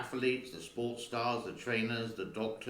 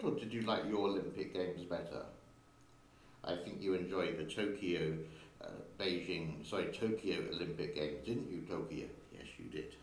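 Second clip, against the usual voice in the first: cooing to the cat; 5 semitones lower, 4 dB quieter than usual. Real, not acted. Teasing the cat directly.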